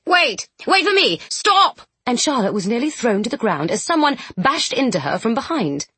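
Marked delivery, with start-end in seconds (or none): 0.1-1.8 high, loud, desperate call; crying out. A narrator's reading. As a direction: yell after the leaving train. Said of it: "a voice cried".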